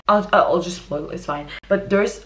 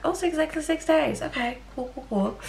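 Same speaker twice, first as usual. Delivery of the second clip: pleasantly surprised; 9 semitones higher, 3 dB quieter than usual. Real, not acted. Realising reading took six days.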